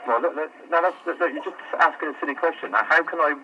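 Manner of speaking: usual speaking voice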